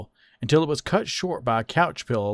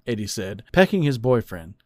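ordinary delivery, nothing marked

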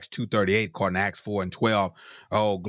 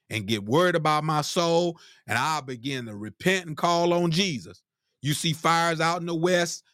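higher, urgent exhortation; rising preaching; 8 semitones higher than usual. Acted. Preacher urges the congregation to repent, rising preaching. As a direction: press the listeners to repent.

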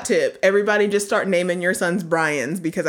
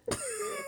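high giggle; 15 dB quieter than usual. Real, not acted. Laughing at own pun.

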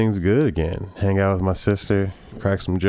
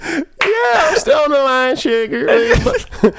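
sexy Southern woman; 5 dB louder than usual. Retold or imitated. Tammy Lynn voiced.

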